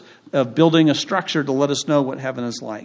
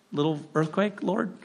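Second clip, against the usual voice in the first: usual speaking voice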